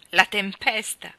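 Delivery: loud, slow exclamation; indignant exclamation. A narrator's reading. Mally challenging the ladies' account, exclaiming loudly. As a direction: throw it back at them loudly. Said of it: "esclamò Mali".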